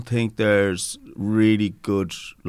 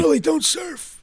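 high, slow, strained movie-star voice; hammed-up Harrison Ford line; Harrison Ford impression; slower than usual. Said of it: "I'm still doing my Harrison Ford impersonation".

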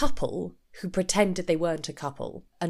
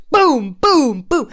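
loud, higher booms like shots fired; booming blows; 5 semitones higher, 9 dB louder, much slower than usual. Retold or imitated. Acting out Tom's accusations landing, loud and punchy. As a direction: punch out each boom.

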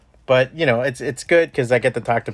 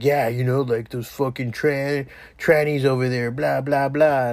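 bigot quote. Retold or imitated. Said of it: "sometimes they'll be like".

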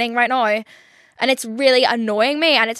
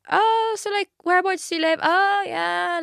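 a much higher, chirpy, fake-polite voice; mock small talk; 8 semitones higher than usual. Mocking a dating-app match's surface-level questions, chirpy and fake. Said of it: "it's the most, like, surface level".

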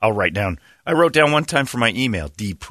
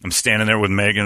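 ordinary talk, nothing marked

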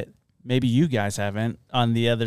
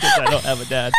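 laughing echo; 4 dB louder, faster than usual. Real, not acted. Echoes friend's line laughing.

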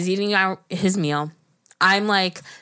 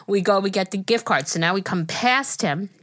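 usual speaking voice

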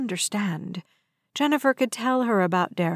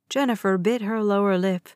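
plain reading voice

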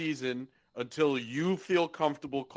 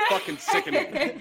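high, laughing fake outrage; mock fury through laughter; laughing mock disgust; 12 semitones higher than usual. Real, not acted. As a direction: pretend to be sickened while cracking up. Mock outrage about rival team.

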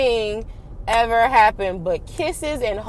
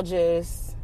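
weary sigh; 10 dB quieter, much slower than usual. Real, not acted. Sighing weary ending.

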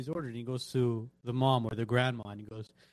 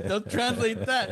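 slow, raised-pitch father, put his foot down; stubborn father; 8 semitones higher, 4 dB louder, slower than usual. Retold or imitated. Dad at the store tells son not to translate, stubborn slow voice. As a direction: drag it out like a stubborn parent refusing. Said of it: "He goes".

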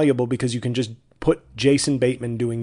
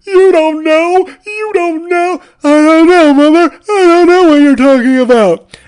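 much higher, much louder screeching duet; overplayed soap-opera climax; escalating melodrama; 14 dB louder than usual. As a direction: squeal it like a stage farce. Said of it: "And scene".

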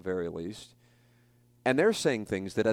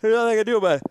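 strained pre-sneeze voice, higher and louder; words squeezed out before a sneeze; about to sneeze; 12 semitones higher, 11 dB louder, much faster than usual. Real, not acted. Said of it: "I'm about to sneeze".

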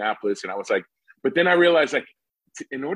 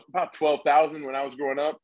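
ordinary delivery

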